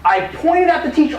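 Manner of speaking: ordinary delivery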